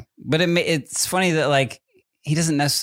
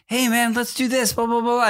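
high-pitched, hyped-up dude talk; hat-backwards guy hyping up a kid; expressive bro voice; 9 semitones higher than usual. Retold or imitated. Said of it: "more expressive hat backwards me".

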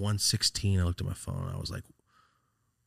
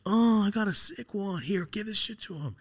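podekscytowany cytat; 13 semitones higher than usual. Retold or imitated. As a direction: act out eager generosity in a much higher voice. Excited gift-giving quote to uncle.

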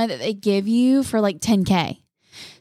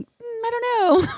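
very high unsure voice; imitating herself unsure; 13 semitones higher than usual. Host imitates herself unsure when asked about news, very high. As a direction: squeak out a clueless answer. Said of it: "I'm like".

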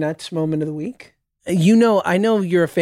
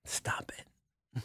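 whisper; 21 dB quieter than usual. Pretend. Pleading with the actor to stop.

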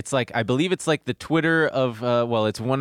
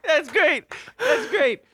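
high, through laughter; laughing; slower than usual. Real, not acted. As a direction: say it while still laughing. Still laughing after the reply.

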